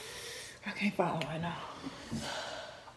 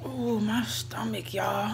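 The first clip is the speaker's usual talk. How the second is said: pained whisper; 10 dB quieter, slower than usual. Real, not acted. Stomach hurting from the spicy wings.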